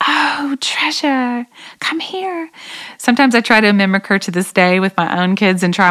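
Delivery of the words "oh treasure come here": put-on high, soft English accent, gently calling; soft-spoken English accent. Imitating her English friend calling her children, in a soft-spoken English accent. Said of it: "has that soft-spoken English accent".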